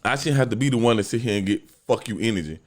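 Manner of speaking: usual speaking voice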